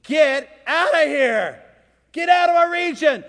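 high, loud shouted demand; urgent pleading. Acted. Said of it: "the people began to plead with Jesus".

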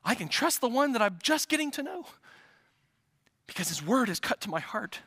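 ordinary talk

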